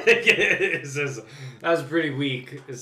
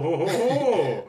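slow sly ho ho ho; evil Santa laugh; 6 semitones higher, 5 dB louder, slower than usual. Retold or imitated. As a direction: chuckle like a Santa who got away with it. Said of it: "a Santa who got away with something".

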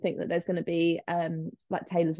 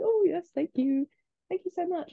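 gushing thanks; 10 semitones higher than usual. Retold or imitated. Employee thanking the boss for offering a hand, high-pitched gushing. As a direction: re-enact slightly overdone, higher-pitched gratitude.

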